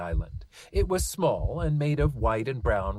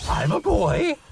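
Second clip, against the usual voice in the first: high, louder eager exclaim; 10 semitones higher, 7 dB louder than usual. Acted. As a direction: blurt it out proudly. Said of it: "Chauncey exclaimed".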